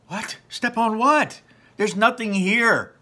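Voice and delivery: high, loud disbelieving cry; panicky Ian. Acted. Ian protesting to Barley, high and panicky. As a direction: squeal it out in disbelief.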